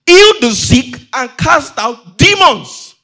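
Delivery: loud, slow, emphatic command; Jesus. Acted. Preacher voices Jesus charging would-be missionaries, booming emphatic command. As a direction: boom out each word as a charge. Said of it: "Jesus is saying".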